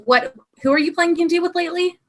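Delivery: ordinary talk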